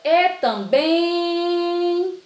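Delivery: high sung-out call, holding "bém" in "também"; drawn-out stressed syllable. Shown as a demonstration. Teacher revealing the tonic syllable, "bém" stretched long. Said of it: "Qual é a sílaba tônica?".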